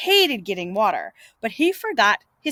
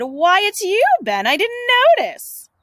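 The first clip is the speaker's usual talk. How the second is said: much higher, louder feigned surprise; mock surprise; 11 semitones higher, 4 dB louder than usual. Tom pretending not to have seen Ben, high mock surprise. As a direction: act as if startled.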